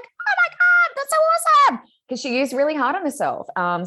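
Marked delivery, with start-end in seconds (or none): 0.0-1.8 squealed much higher and louder; imitating her excitement. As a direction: play the thrilled client's squeal. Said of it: "And she's like".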